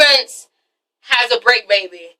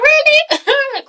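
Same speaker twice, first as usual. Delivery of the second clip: high-pitched disbelief; 13 semitones higher than usual. Retold or imitated. Acts Terrence's reaction to pregnancy claim.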